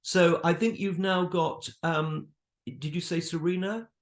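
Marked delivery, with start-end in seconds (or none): none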